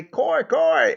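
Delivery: high piggy "Coy, coy" squeak; pig squeal imitation. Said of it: "And mind ye grumph and say".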